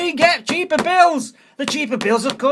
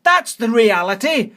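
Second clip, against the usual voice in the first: ordinary talk, nothing marked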